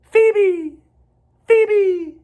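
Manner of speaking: high, loud bird cry; loud high-pitched birdsong; phoebe call. A narrator's reading. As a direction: call it out bright like a little bird on a bough. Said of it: "wags its tail and says".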